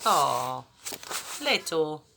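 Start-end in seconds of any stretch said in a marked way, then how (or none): none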